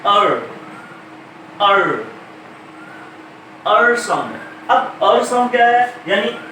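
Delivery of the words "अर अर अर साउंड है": अर with a light, barely there र; er sound. Shown as a demonstration. Teacher modelling the अर vowel, र kept light. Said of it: "उसके बाद ये sound है".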